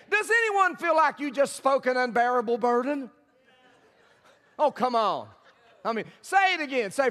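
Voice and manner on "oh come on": usual speaking voice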